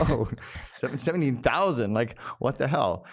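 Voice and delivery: retelling his shock with a laugh; astonished laugh. Retold or imitated.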